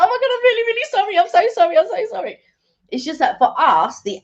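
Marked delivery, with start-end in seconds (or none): 0.0-2.4 high, embarrassed gushing apology; retelling her own mortified high-pitched sorry; flustered apology. Retold or imitated. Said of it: "And I was like".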